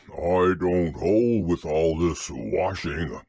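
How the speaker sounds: low, slow grumble; grumbling Eeyore. A narrator's reading. Eeyore objects to all this washing, in a low, slow grumble. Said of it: "grumbled Eeyore".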